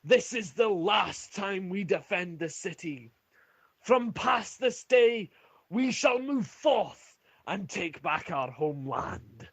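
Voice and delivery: high, drawn-out rallying speech to the troops; rally your soldiers from the battlements, pitched high; commander's war cry before battle; rousing battle shout. Acted. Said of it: "continues to shout".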